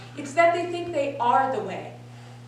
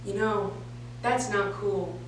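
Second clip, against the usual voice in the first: lightly voiced anti-drug lecturer; low, measured, mock-earnest talk; a hint of the school drug officer, lower; 6 semitones lower, 6 dB quieter, slower than usual. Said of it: "in true Dare Truth fashion style".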